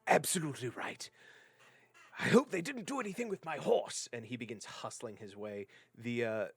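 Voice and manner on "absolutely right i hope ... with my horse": loud, raised gentleman's voice; indignant gentleman, pitched high and emphatic; well-dressed gentleman. Acted. Rescued gentleman worried about his horse, fussy gentleman voice. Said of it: "a rather well-dressed gentleman".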